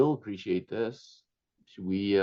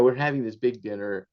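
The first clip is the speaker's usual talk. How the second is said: ordinary talk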